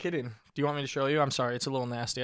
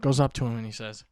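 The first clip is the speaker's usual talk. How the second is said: normal talking voice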